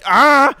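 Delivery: sudden high, loud yell; real yelp of alarm; startled scream. Real, not acted. Startled at pit entry.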